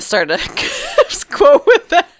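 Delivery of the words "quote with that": words squeezed out through laughter; laughing. Real, not acted. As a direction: laugh through the end of the line. Cracking up mid-sentence.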